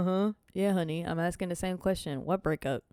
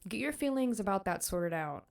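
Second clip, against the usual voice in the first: ordinary delivery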